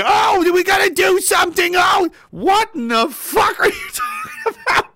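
mock hysterical pundit. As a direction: whine like a panicking anchor.

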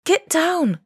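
loud, high urgent warning; crying out. A narrator's reading. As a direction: shout it, pitched high. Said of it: "cried Corentine".

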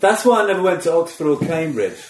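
chuckling at own joke; amused, laughing aside. Real, not acted. Joking about snooty schools, laughing.

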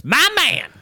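high, loud hype cheer; excited shout. Real, not acted. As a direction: call it out like a fan. Cheering the wrestler's entrance.

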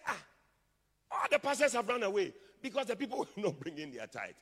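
high, loud indignant cry; wild outburst. Acted. Preacher acting the man of God finding pastors gone, wild. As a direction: burst out wildly over the desertion. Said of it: "The man of God became wild".

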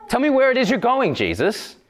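high, fast, eager asking; a lightly voiced puzzled disciple pressing Jesus; questioning Thomas.

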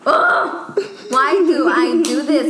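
high groan of annoyance at herself; self-annoyed groan. Real, not acted. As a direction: groan at your own habit. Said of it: "I need to find another phrase".

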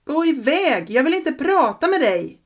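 frightened shout. Acted. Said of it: "Jag ropar".

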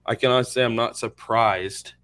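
ordinary delivery

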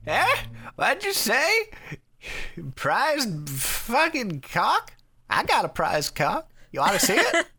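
put-on high creaky old geezer voice; hammed-up deaf old man. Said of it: "Doing an old man voice".

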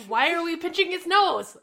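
high, loud incredulous question; a listener reacting in mock bafflement; baffled question. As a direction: ask it in mock disbelief. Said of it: "I'm like".

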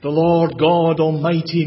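usual speaking voice

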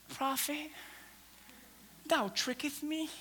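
very soft, slow, archaic politeness; hushed mock-biblical reproach; mock meek complaint. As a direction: complain far too gently and formally. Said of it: "This woman wasn't like".

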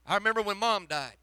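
ordinary talk

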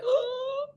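high startled gasp; mock surprised intake of breath; sharp gasp. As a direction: gasp as if a ring just appeared. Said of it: "my face was like".

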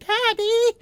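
high, put-on child's voice; little kid voice. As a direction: whine for daddy like a small kid. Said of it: "That was my little kid voice".

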